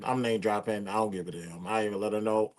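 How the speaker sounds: ordinary delivery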